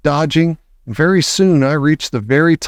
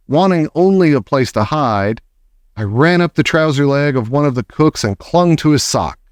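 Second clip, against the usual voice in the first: ordinary delivery, nothing marked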